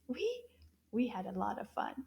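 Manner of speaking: ordinary delivery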